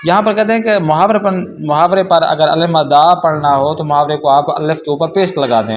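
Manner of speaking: ordinary talk, nothing marked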